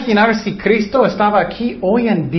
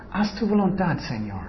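humble prayer; 9 dB quieter than usual. Shown as a demonstration. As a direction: pray it softly in surrender. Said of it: "puedes decir en sus oraciones".

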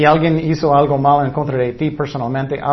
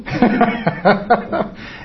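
light amused chuckle; a quick laugh at the joke; laughing at his own parody; short laugh; 7 semitones higher than usual. Real, not acted.

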